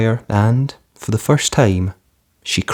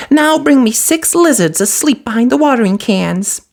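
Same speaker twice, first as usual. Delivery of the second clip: high brisk fairy voice; quick and businesslike; fiery fairy. A narrator's reading. Fiery fairy asking Cinderella for mice, brisk.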